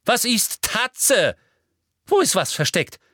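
very high, loud, hungry; eager shrill demand. A narrator's reading. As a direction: press greedily for answers. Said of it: "blickte begierig". (begierig schrill)

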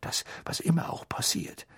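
soft, half-whispered urgency; hushed, hasty plea. A narrator's reading. Mister Weasley presses Harry before departure, hushed and urgent. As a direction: press the point very quietly. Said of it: "sagte Mister Weasley noch hastiger".

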